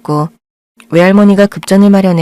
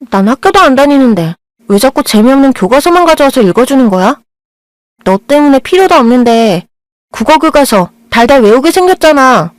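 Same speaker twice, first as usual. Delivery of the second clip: irritated complaint; 5 semitones higher than usual. Acted. Blind girl griping at the boy reading textbooks to her. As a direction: whine and snap at the pest.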